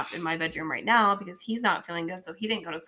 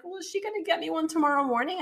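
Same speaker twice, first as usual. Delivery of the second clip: put-on higher, eager boy voice; imitating a hopeful kid; 10 semitones higher, faster than usual. Mom mimics her son asking about tomorrow, higher kid voice. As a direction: ask like a hopeful child. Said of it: "he's like".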